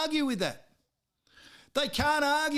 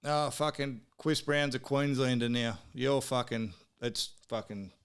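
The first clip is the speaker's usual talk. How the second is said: getting emotional; 10 semitones lower, 3 dB quieter than usual. Real, not acted. Fan lamenting [name] turning Queenslander, emotional fade. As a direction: voice dropping lower and fading into near silence. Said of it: "I'm a bit emotional now".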